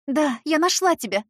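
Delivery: high loud joyful exclaim; joyful cry. Acted. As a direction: cry out in relief.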